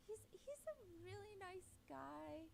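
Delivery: slow, hesitant forced compliment; grudging slow praise after a sigh; reluctant praise. Retold or imitated. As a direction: say it like you're holding something back. Reluctant answer to her mom.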